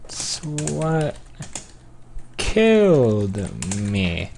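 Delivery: stretched-out words with a pause; slow dictation. Real, not acted. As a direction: draw each word out while typing. Slowly sounding out typed message.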